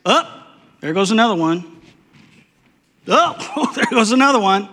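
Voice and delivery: high, laughing oh, there goes another; dark chuckle at each one falling; mock narration. Preacher imagines Israelites dropping in the desert, laughing.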